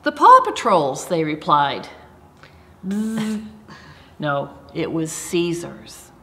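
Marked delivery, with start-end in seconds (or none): 0.0-1.0 high, loud silly answer; excited exclamation. Acted. Deliberately wrong silly answer. 2.9-3.5 buzzing buzzer noise; wrong answer buzzer. Pretend. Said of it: "make a buzzer sound like this".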